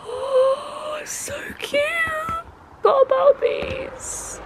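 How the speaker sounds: breathless whispered delight; awed whisper. Real, not acted. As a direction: hush it, amazed.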